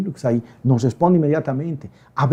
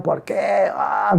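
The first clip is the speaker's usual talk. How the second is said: higher, mock childish wail; Chilindrina crying; 5 semitones higher, 4 dB quieter, much slower than usual. Preacher mimics believers complaining to God, mock crying. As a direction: whine it as a hammed-up cry. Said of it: "como la chilindrina llorando".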